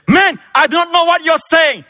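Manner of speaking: very high, loud outburst; furious denial. Acted. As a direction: explode at the accuser in a high shout. Peter's last heated denial.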